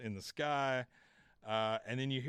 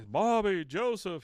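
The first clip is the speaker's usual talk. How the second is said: loud, higher calling out of names; 8 semitones higher, 7 dB louder, slower than usual. Retold or imitated. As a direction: call the lost kids across the desert. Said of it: "he's calling out to 'em".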